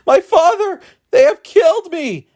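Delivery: high, loud desperate cry for help; terrified cry. A narrator's reading. Boy cries to his father as he is struck. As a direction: scream out to your father. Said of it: "He heard Ikemefuna cry".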